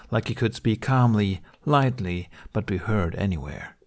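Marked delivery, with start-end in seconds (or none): none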